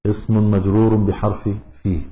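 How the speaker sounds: usual speaking voice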